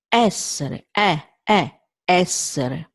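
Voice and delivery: essere with a wide open first E; Italian open E. Shown as a demonstration. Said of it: "open E".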